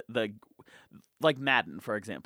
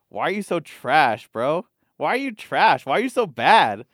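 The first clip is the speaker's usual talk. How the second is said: louder, taunting imitation of a bully; mock berating; 2 semitones higher, 7 dB louder than usual. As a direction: needle a kid with mock scorn. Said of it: "keeps berating him".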